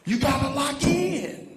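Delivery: high, loud rallying shout; rally the crowd; loud preaching. Real, not acted. Crowd urged, climax.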